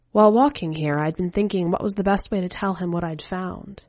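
plain reading voice

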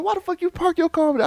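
very high, fast, incredulous; exasperated fast scold. Retold or imitated. Man scolding girlfriend about parking, fast high scold. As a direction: fire it at her in disbelief.

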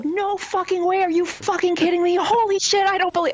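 high, loud squeal of disbelief; fake excited shriek. Caller hamming up her win to the DJ, high excited shriek. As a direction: play up the shock for the radio DJ. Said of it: "at which point I just kinda let it go".

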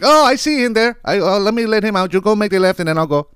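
high put-on courteous driver voice; mock polite driver. Imitating the polite driver who should have let him out, mock courteous. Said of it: "instead of saying".

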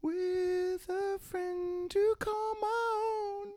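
put-on high slow child singing; kid voice. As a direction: croon high like a young boy. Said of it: "let me do the kid voice".

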